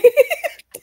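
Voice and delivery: loud, high laugh. Real, not acted. Laughing at the clip's insult.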